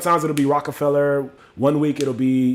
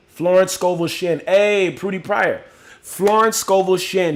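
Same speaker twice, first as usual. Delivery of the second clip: higher, slow, drawn-out delighted hail; excited calling out; 5 semitones higher, 4 dB louder, slower than usual. Real, not acted. Host greeting a viewer and a favorite author, excited. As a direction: call the names out with relish.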